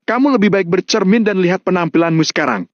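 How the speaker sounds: high, scolding rebuke; acted yell without extra volume; angry shout. A narrator's reading. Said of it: "menunjuk Mario sambil berteriak".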